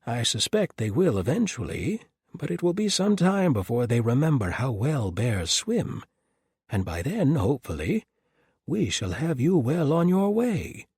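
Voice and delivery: a jolly peddler reassuring his guests; cheerful Doc Mason voice. Acted. Doc Mason greets the pair.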